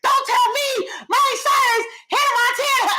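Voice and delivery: high, loud retold shouting of names; excited high shout of the names; excited yelling. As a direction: yell the names like you just figured it out. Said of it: "I was like".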